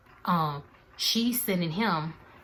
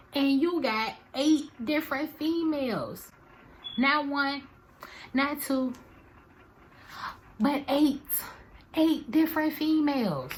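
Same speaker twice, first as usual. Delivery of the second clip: indignant outburst; 10 semitones higher than usual. Real, not acted. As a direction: hammer each number in outrage. Indignant about eight women.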